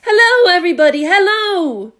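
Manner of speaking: very high, loud happy greeting; small boy greeting the crowd below; cheerful call. A narrator's reading. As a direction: call out with delight. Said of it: "laughing and waving and calling out".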